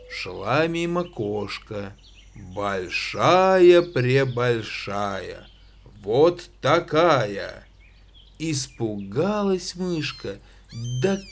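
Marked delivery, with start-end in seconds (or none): 2.4-7.7 slow, low "большая-пребольшая"; drawn out. A narrator's reading. Showing a big cat. (протяжно и низко «большая-пребольшая»)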